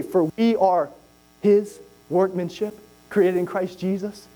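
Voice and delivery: slow verse recital; slow measured scripture reading. Acted. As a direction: read the verse slowly.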